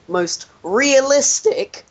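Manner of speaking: REALISTIC drawn out, high; stress on realistic. Acted. Reading a glowing review title aloud, stress on realistic.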